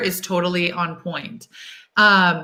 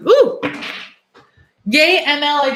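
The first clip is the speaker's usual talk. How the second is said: delighted squeal; 7 semitones higher, 3 dB louder than usual. Real, not acted. Sees viewer's message, delighted.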